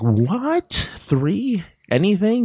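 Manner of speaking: can't believe the offer of three wishes; squeaky amazement at a magic offer; surprised. Acted. Wish-maker reacting to genie.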